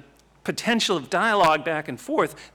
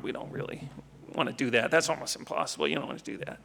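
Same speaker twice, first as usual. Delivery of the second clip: conspiratorial hush; 4 semitones lower, 8 dB quieter, faster than usual. Retold or imitated. Teacher steering student from Mozart.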